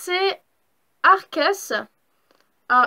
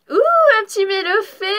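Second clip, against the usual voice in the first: delighted; 5 semitones higher, 6 dB louder than usual. Real, not acted. Cute card pull.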